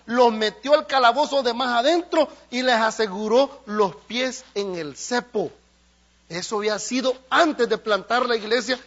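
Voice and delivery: loud, driving pulpit preaching; fiery preaching. Acted. Preacher rousing the congregation, loud and driving. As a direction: preach it with full force.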